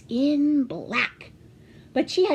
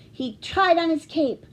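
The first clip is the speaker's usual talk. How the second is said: ordinary delivery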